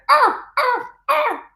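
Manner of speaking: very high yappy arf; very high playful puppy barks; dog bark. Pretend. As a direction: yip like a tiny puppy. Dog sound in children's song.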